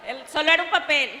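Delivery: amused. Real, not acted. Explaining joke, still amused.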